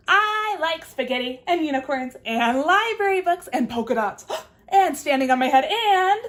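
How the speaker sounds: loud, gushing list with a gasp; excited chatter. Acted. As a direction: rattle off favourites with delight. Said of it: "talks about what she likes".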